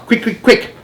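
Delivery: very high hurried calls; urgent. A narrator's reading. Giant urges Sophie for a jar, very high and hurried. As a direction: rush her.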